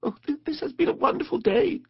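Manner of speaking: very high, stammering delight; delighted Mole. A narrator's reading. Mole thanks Rat for the boat ride, thrilled. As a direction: squeak it with wonder.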